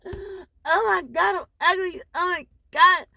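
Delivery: high, hammed-up wail of self-pity; mock self-pity. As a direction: whine it like you're crushed and ugly. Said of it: "You could take that what and be like".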